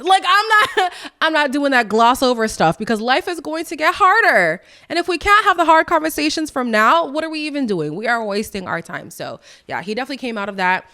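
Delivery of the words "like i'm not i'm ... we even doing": much higher and louder, laughing; fired up and insistent; emphatic. Real, not acted. Heated emphatic rant.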